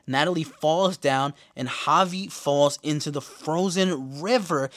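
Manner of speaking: ordinary talk, nothing marked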